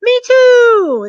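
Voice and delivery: high, loud mock squeal; mock excited squeal. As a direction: squeal back in fake delight. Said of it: "the bank rep's not gonna go".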